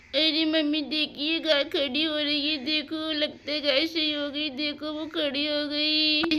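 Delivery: put-on high, delighted child voice; excited child. Child showing mother the cow is standing, high and excited.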